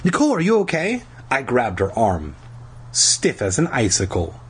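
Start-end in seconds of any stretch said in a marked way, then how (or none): 0.0-1.0 high, quick, worried question; alarmed. A narrator's reading. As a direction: ask urgently, pitch raised. Narrator acts alarmed boy finding sister.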